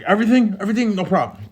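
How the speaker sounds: high-pitched eager compliance; hyper cellmate. Retold or imitated. Cellmate answering the guards' order to strip, hyper. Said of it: "he's just high energetic".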